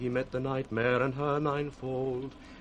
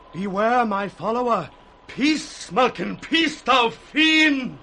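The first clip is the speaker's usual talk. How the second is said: loud, higher mad shouting; raving at fiends; 8 semitones higher, 8 dB louder than usual. Acted. Poor Tom rebuking imagined fiends, shouting in frenzy. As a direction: drive off invisible devils.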